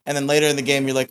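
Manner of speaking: normal talking voice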